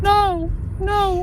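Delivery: high, frantic no; panicked pleading. Real, not acted. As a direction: beg in real panic.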